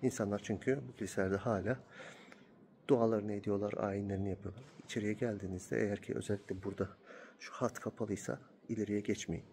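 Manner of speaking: hushed voice inside a church; quiet. Real, not acted. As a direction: keep it low and respectful.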